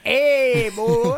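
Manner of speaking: high, loud country holler; country dude voice. Pretend. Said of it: "a whole podcast like that".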